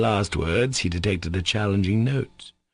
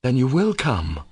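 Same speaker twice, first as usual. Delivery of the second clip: firm statement, weight on "will"; 5 semitones higher than usual. A narrator's reading. Alyosha, seeing her tears, settles it firmly. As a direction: state it firmly, voice raised in pitch. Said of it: "said Alyosha firmly".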